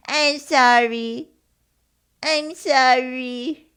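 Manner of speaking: put-on very drawn-out, high and loud baby talk; babyish receptionist voice. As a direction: stretch it into a mock baby apology. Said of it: "This was her voice".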